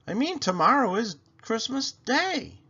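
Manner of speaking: high, loud lady of the house calling; Mrs Rogers voice. Acted. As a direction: call her name up high like the lady of the house.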